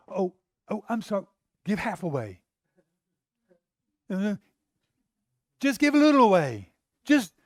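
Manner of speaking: mock begging. A mock Jesus chasing the ruler, begging loudly. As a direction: plead like a salesman caving in. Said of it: "run after this guy and go".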